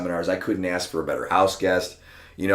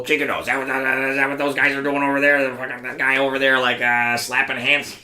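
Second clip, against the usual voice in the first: higher, sneering tough-guy voice; mocking Tony; 7 semitones higher, 4 dB louder than usual. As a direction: scoff at the slapping drills.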